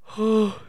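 loud high yawn; yawning before reading. Real, not acted. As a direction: let out a big tired yawn. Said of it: "Sorry, I'm yawning".

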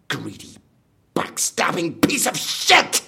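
rising fury at the betrayer; bitter angry snarl at the end; angry outburst. Acted. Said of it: "you're a bit angry".

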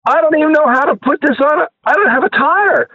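high-pitched, flustered retelling of his own panic; exasperated panic. Retold or imitated.

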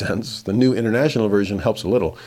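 ordinary delivery

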